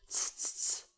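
whispered tutting clicks; disapproving tutting. A narrator's reading. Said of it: "räusperte sich der Gefragte".

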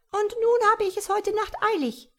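high, chirping little creature; friendly creature. A narrator's reading. Tiny creature reassuring frightened Tom, high and chirpy. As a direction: reassure him in a tiny birdlike voice.

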